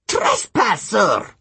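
high, drawn-out demon accusation; demon shriek. Acted. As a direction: screech at the intruder. Demon spots the intruder.

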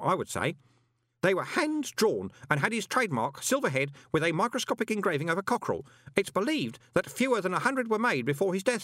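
high, scholarly recital; pin-obsessed boy Stanley. Acted. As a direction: recite catalogue facts in an eager high voice.

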